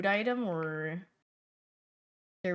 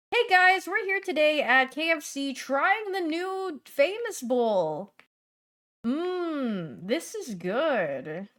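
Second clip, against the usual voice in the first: much higher, loud "Hey guys" then slow "this is good"; hyped reviewer intro; 7 semitones higher, 4 dB louder, slower than usual. Retold or imitated. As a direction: open a review video with fake hype.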